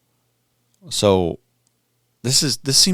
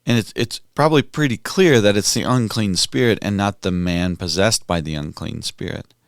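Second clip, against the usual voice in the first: usual speaking voice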